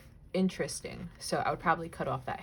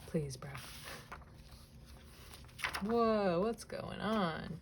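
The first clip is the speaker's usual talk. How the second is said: normal talking voice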